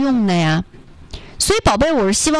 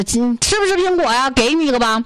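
put-on higher, friendly Northeast accent; 5 semitones higher than usual. Northeast brother offers the student an apple, in Northeast accent. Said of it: "操着一口这个东北口音的大哥".

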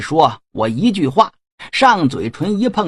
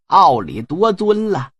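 ordinary delivery

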